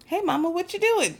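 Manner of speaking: put-on high, sing-out toddler greeting; little girl. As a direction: imitate a child acting innocent. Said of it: "she hops down from the stool and she goes".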